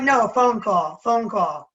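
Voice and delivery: loud Jersey "phone call"; Jersey-accented correction, "phone call". Shown as a demonstration. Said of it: "And we say, like".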